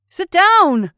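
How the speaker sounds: loud, high command; shouted order. A narrator's reading. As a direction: order them down sharply. Orders standing man in boat.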